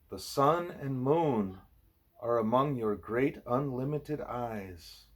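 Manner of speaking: each word drawn out slowly; slow reverent quote. Acted. Reader quoting Arjuna to Krishna, slow and solemn. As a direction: lay out the scripture line slowly and solemnly. Scripture quote read aloud.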